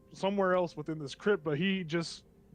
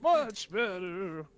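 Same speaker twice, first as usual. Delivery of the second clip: slow, drawn-out relief; voice returned normal; 3 semitones higher, much slower than usual. Acted. Gnome after coughing his voice back, drawn-out words. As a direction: stretch both words out in character. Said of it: "His voice turns to normal".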